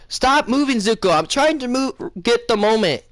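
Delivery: high-pitched fussy complaint; mock exasperation. Sokka telling Zuko to hold still, mock exasperation. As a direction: imitate a painter pleading for stillness, pitched high.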